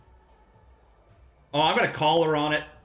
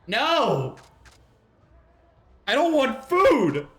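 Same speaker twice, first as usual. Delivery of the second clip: high, loud, slowed protest at the game; frustrated shout; 10 semitones higher, 6 dB louder, much slower than usual. Real, not acted. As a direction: yell at the screen in mock outrage. Game menu gives wrong option.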